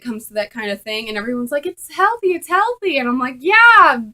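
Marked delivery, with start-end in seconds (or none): 1.7-3.0 high, singsong "it's healthy" parroted; mimicking pushy friends. Mimicking everyone's insistence. 3.4-4.0 sarcastic yeah. Retold or imitated. Sarcastic reply.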